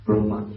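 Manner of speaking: Brahma said as 'Broh-ma'; Cambodian pronunciation. Shown as a demonstration. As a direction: round the first vowel of Brahma to 'oh'. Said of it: "In Cambodia".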